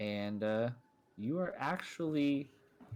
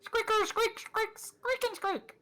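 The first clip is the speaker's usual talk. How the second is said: very high squeaky toy voice; rubbery high squeaky toy. Acted. GM voices mouse Cinder squeaking.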